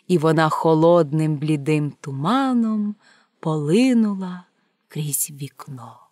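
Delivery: usual speaking voice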